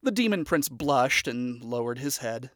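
plain reading voice

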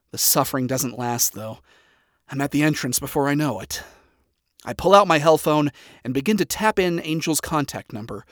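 ordinary delivery

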